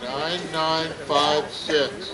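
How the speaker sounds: drawn-out digits for the crowd; slow number call. Real, not acted. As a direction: call out each number slowly and evenly. Repeating raffle number to the crowd.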